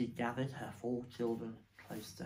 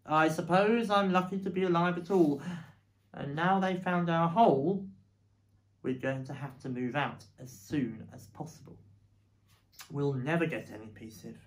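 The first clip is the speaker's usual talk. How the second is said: louder, higher thinking aloud; Mr Fox musing; 4 semitones higher, 7 dB louder than usual. A narrator's reading. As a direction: talk it through to himself.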